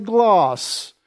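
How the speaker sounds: glass said posh, with a long "ah"; plummy posh English "glahss"; posh English accent. Retold or imitated. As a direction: imitate posh English with a drawn-out vowel. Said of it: "The posh talk as if they have a plum".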